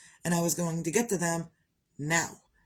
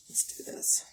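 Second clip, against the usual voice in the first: breathy whispered pep talk to herself; soft private whisper; 6 dB quieter than usual. Real, not acted. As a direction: whisper it to yourself, steeling up. Talking herself into starting.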